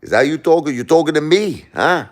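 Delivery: loud, high tough-guy challenge; hammed-up movie wise guy picking a fight; Robert De Niro. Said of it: "do your best Robert De Niro impression".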